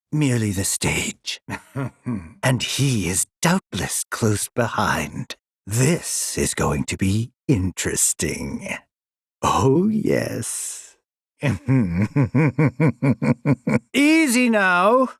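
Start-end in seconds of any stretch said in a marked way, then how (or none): 1.5-2.2 short, soft closed-mouth chuckle; sinister chuckle. Acted. Villain anticipating his quarry, sinister chuckle. 11.4-13.9 long bubbling closed-mouth snicker; villainous chuckle. Acted. Villain anticipating something interesting, gleeful snicker.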